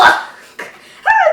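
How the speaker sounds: very high exasperated laughing cry; frustrated laugh. Real, not acted. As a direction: let out an exasperated yelp.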